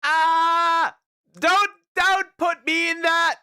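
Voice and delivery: high, loud frightened yelp; scared scream. Real, not acted. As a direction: shriek in fright. Scared by puppet image.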